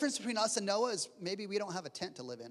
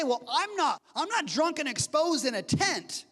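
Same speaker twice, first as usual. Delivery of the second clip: defensive protest; 8 semitones higher, 5 dB louder than usual. Retold or imitated. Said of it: "we might say".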